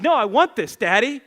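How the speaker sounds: lightly voiced pleading child; protesting small son. Small son begs his dad to keep the tablet, protesting.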